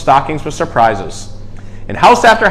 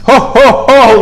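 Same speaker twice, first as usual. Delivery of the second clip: high, loud belly laugh; jolly ho ho ho; 8 semitones higher, 6 dB louder, slower than usual. A narrator's reading. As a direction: boom out a jolly Santa laugh. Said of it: "laughed Santa".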